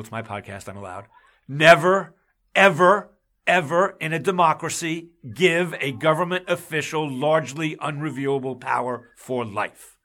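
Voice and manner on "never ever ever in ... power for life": louder, heated start that eases toward the end; real passion rising on never, ever, ever; emotional outburst. Real, not acted. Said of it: "I'm getting emotional".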